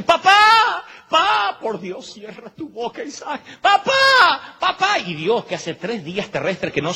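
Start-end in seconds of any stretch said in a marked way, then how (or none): 0.0-1.6 put-on high, loud child's desperate cry. Isaac calls from the altar. 1.6-3.4 softer and higher, pleading; Abraham pleading for the boy to stop, softly; hushed plea. Acted. Abraham begs silence to himself. 3.6-5.0 put-on high, loud child's desperate cry. Isaac calls from the altar.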